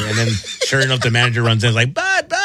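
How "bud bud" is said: high frantic calling out; panicked high call. Retold or imitated. Manager runs in calling.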